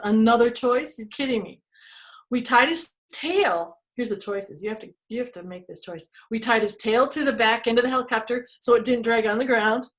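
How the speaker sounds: usual speaking voice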